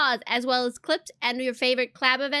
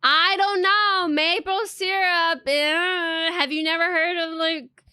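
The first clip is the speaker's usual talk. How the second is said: high, loud, drawn-out whine; exaggerated doubt; 4 semitones higher, 4 dB louder, slower than usual. Streamer doubting the suggested tag, exaggerated doubt. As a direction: stretch the doubt out, pitched up. Protesting the maple syrup tag.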